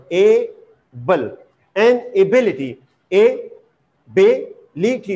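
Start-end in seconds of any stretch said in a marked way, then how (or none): 0.1-1.4 "able" split in two, a-ble; syllable by syllable. Shown as a demonstration. Teacher showing a two syllable word, split apart. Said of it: "this is two syllable word". 3.1-5.1 "ability" slowly split into four parts; syllable by syllable. Shown as a demonstration. Teacher showing a four syllable word, slowly split. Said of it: "this is four syllable word".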